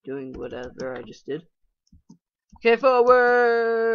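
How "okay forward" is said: loud, slow drawn-out battle cry; slow, loud charge order; drawn-out loud rally cry; self-command. Real, not acted. Commanding himself in game.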